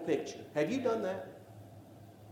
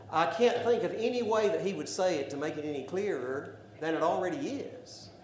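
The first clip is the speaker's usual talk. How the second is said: ordinary talk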